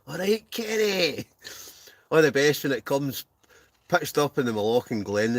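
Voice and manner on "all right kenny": put-on voice. Greeting in a put-on voice.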